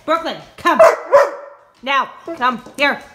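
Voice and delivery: loud, firm command to the dog; firm loud call. Real, not acted. Owner loudly calling her dog to come. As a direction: raise the voice to make her come.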